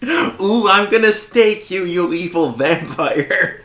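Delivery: high, loud teasing squeal, slowing at the end; hammed-up playful threat in a high voice; mock Buffy role-play. Harmony playing Buffy for Spike, high hammed-up squeal. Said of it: "she pretends to be Buffy".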